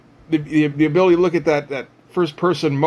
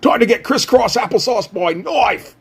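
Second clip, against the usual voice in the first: hammed-up Cockney-Australian villain accent, pitched higher; horrible Cockney accent; 8 semitones higher than usual. Villain Knife taunting Hawkstab before a duel, in a hammed-up Cockney accent. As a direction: sneer it in a bad Cockney accent, like Kano. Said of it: "reworked all of his dialogue to do a horrible Cockney accent".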